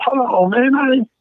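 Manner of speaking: mock outrage, voice climbing; exasperated retort. Retorting to pushy phone caller.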